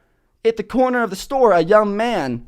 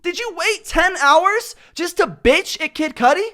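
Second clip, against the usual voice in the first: mock outrage at heckler; 8 semitones higher than usual. Ranting at a front-row heckler, mock outraged. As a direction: scold the heckler in disbelief. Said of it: "I'm like".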